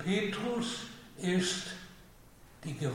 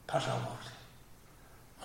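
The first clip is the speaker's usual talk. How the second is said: whisper; 4 semitones lower, 10 dB quieter, much slower than usual. Acted. The Lord charges Peter.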